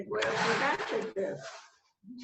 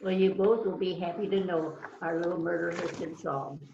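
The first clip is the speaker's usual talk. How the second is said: ordinary delivery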